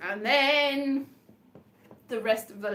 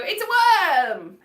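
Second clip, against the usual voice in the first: mock shout; 6 semitones higher, 7 dB louder, slower than usual. Friends shouting at TV show.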